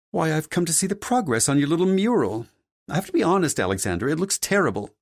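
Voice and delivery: haughty mother character. Acted. Mother critiques her son's mural, haughty high character voice. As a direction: pass judgment as the imperious mother.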